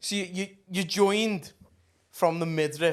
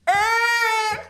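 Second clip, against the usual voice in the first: high, loud startled yelp; terrified shriek, retold; shocked scream; 7 dB louder than usual. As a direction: shriek like you've just been grabbed in the shower. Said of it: "And I just went".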